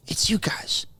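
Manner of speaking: whispered blame; hushed accusation; a stage whisper, pointing the finger. Acted. Mock secret blame.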